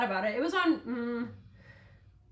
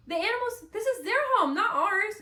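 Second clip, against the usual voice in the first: high-pitched, upset protest; heartbroken; 9 semitones higher than usual. Real, not acted. Podcaster defending animals' home to listeners, high and heartbroken. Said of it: "that's so heartbreaking".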